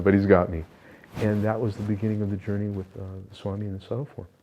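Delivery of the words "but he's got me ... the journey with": normal talking voice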